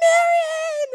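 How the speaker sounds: high, loud shout across the house; child screaming a name. Retold or imitated. Little son yelling the neighbor's name out the window. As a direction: yell her name as she rolls past. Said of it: "Judah screams at you all the time".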